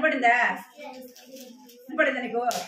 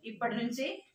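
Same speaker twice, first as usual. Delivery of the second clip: softer, lowered plea; hushed child's prayer; 3 semitones lower, 6 dB quieter than usual. Acted. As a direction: model a quiet prayer for the children.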